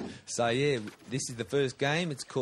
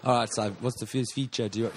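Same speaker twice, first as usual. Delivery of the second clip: normal talking voice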